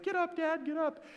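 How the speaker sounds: high, desperate cub voice; young Simba pleading. Retold or imitated. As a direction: beg him to wake up. Simba pleading over dad.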